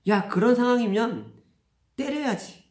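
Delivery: retold indignant egging-on; emphatic urging. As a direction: urge the child on with sudden force. Father voices his rash advice.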